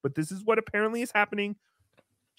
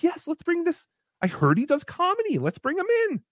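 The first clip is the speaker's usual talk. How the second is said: high manager voice; 10 semitones higher than usual. Retold or imitated. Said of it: "The Kroger was probably like".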